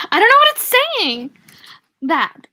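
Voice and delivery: high, loud outburst of bafflement; real exasperated cry. Real, not acted. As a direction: throw up your hands at the text, pitch leaping up. Reader gives up on garbled text.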